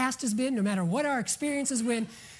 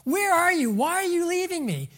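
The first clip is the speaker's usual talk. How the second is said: high, loud desperate cry for a parent; a son pleading for his dad and mom; child crying out; 7 semitones higher, 4 dB louder than usual. Acted. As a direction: call out like a child left alone. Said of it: "your son crying out".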